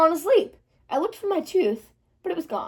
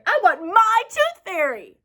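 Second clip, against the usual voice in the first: stomping foot; 6 semitones higher, 4 dB louder, slower than usual. Acted. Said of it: "I said, stomping my foot".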